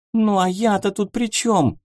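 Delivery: high, squeaky frightened protest; frightened squeal. Acted. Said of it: "испуганно взвизгнула".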